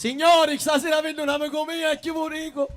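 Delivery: put-on thick Palermo Sicilian accent, high and loud; broad street Palermitano, called out to the crowd; strong Palermitan dialect. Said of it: "dicono palermitano proprio stretto proprio". (dialetto palermitano stretto)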